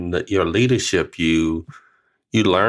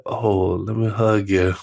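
robotic hug; slower than usual. Retold or imitated. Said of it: "it looks so robotic".

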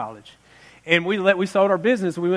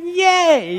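high, loud fake cheer; mock yay; 10 semitones higher, 5 dB louder than usual. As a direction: cheer with forced enthusiasm. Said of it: "I'm like".

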